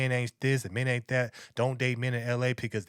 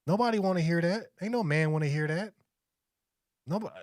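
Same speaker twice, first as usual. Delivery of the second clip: ordinary talk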